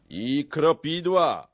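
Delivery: loud high rallying cry; loud, drawn-out chiming in; rousing brawler. A narrator's reading. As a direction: call it out slowly and boldly. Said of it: "rzekł chrzciciel".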